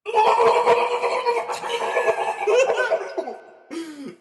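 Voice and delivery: loud laughing scream into water; scream into a bowl. Real, not acted. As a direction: let out a huge shrieking scream. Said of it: "Ready for a scream?".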